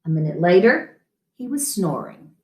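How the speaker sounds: plain reading voice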